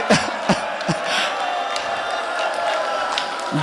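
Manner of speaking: breathy drawn-out sob; long anguished wail. Acted. Child's voice heard, wail.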